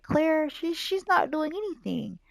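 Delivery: high-pitched play-acted complaint; complaining. Boy complains his sister isn't checking on him, high mock grievance. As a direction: complain about her in a high child's pitch.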